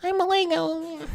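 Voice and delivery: very high squeaky little Lego character voice; tiny falsetto Lego figure saying hi; childhood toy voice. Retold or imitated. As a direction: squeak it out like a kid voicing a toy. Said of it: "I'd be like this".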